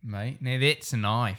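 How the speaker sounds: slow Australian movie-quote impression; Aussie accent, drawling the line. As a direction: draw it out in mock Australian. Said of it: "don't say it in an accent".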